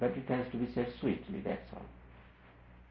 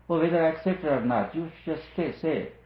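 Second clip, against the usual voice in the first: higher and louder, a mock brash disciple insisting; defiant demand; 8 semitones higher, 5 dB louder than usual. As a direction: play the cocky student daring the guru to say it. Imagined disciple demanding truth bluntly.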